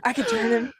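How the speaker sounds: high mock-groupie voice breaking into laughter; giggly, high imitation of a smitten fan; besotted groupie. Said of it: "groupies for serial killers".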